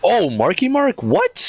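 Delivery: high, squeaky, incredulous; stunned disbelief. Real, not acted. Friend hears Wahlberg was cast, shrieks in disbelief. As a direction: hear the name and can't believe it. Shocked at casting news.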